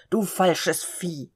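langsam, verächtlich ausgesprochen; schimpfend gerufen. A narrator's reading. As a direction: dem Pferd die Beleidigung entgegenschleudern. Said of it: "rief der Kutscher aufspringend". (Kutscher beschimpft sein Pferd, langsam und verächtlich)

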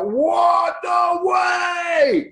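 high, loud, drawn-out mock amazement; astonished disbelief. Imitating an astonished man hearing about the cycling, high amazed shout. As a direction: exclaim it like a flabbergasted local. Retold astonished reaction of a listener.

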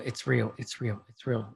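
low, subdued repeated mumble; retold muttering. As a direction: imitate her muttering, soft and low. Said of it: "She keeps muttering".

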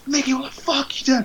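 high, soft mock reprimand; restrained telling-off. Coach pulling his fighter up by the neck, restrained telling-off. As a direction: imitate a coach's hushed, high-pitched scolding. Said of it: "it wasn't like a hairdryer".

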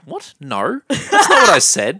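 squeaky high then slow, laughing denial; awkward denial. Retold or imitated. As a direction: protest it, laughing. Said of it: "make it really awkward by going".

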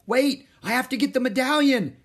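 put-on high, loud boy's yell; urgent shout. A narrator's reading. Boy going back for the medallion, shouting. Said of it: "shouted Jack".

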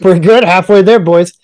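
loud, high cheerful mock general; rally the troops like nothing's wrong; mock cheery commander. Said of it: "mock Russian commander".

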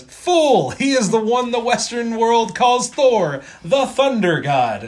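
hammed-up soldier rebuking a comrade; scornful second soldier. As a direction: declaim it grandly, starting high and settling down. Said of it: "And the next soldier says".

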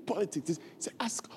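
hushed aside under the breath; soft whispered aside. Real, not acted. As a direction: lean in and whisper it.

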